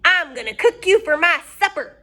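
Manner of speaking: high, loud gloating witch. Acted. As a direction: relish it like a hungry witch. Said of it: "said Bony Legs".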